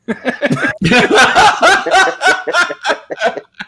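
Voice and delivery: long hearty laugh at own joke; loud high laugh. Real, not acted. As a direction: burst out laughing loudly.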